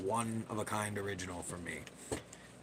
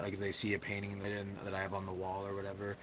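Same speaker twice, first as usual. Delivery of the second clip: ordinary delivery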